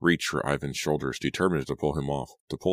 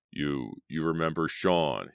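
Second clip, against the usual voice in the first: plain reading voice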